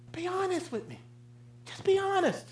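soft, high pleading; soft high plea. Real, not acted. Preacher pleads softly with the congregation, high and hushed. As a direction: softly beg them to be honest.

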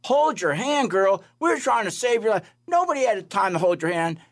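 nurse's indignant mock scolding. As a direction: scoff at the idea, incredulous.

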